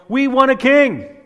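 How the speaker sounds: loud, high demanding cry; a crowd demanding its king; loud insistent crowd cry. Acted. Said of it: "So they cried out to Samuel".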